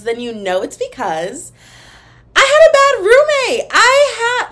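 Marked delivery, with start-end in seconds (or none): 2.4-4.5 high, loud dramatic wail; hammed-up screaming outburst; mock-hysterical yell. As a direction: yell the confession at the top of your voice. Mock outburst revealing bad roommate.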